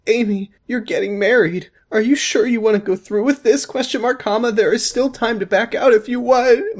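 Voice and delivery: high put-on mom, near tears; the mom pleading with her daughter, voiced up high; tearful mom voice. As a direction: play the worried mom up high. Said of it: "My mother began to cry".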